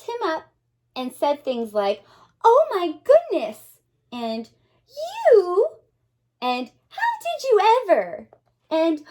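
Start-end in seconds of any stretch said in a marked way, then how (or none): 2.4-3.8 excited exclaim. Acted. 4.9-5.7 amazed question. Acted. 6.9-8.3 amazed question. Acted.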